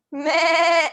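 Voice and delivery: high bleating goat noise; goat impression. Shown as a demonstration. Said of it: "I can make a really good goat impression".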